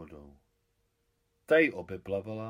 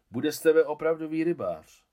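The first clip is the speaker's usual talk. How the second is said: hearty praise; 6 semitones higher, 7 dB louder than usual. Acted. Adam praises boy loudly.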